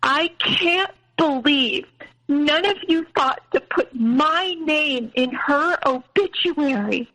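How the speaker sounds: imitating an offended mom, high and slow; mom's voice. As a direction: play the wounded mother, drawing each word out. Mom's complaint quoted.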